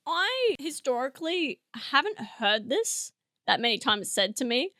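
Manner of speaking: normal talking voice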